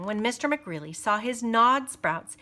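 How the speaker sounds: plain reading voice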